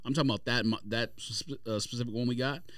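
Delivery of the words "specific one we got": ordinary talk, nothing marked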